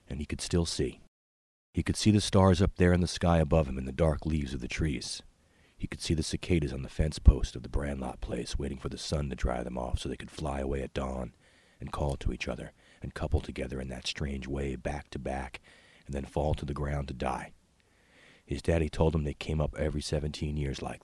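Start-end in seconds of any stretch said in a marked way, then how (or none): none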